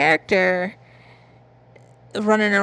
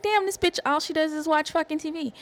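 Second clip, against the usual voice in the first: high, scoffing imitation of a fed-up fan; a pretend viewer mocking her TV habit; mock exasperated viewer; 7 semitones higher, 5 dB quieter than usual. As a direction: voice an annoyed viewer rolling their eyes, pitched way up. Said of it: "I know you're like".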